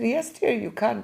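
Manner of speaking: put-on kindly old gentleman answering the phone; high old-man voice on the telephone; elderly man.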